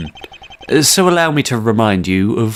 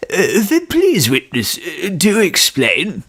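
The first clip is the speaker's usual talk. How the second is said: put-on old man voice; 7 semitones higher, slower than usual. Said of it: "get the old man voice down".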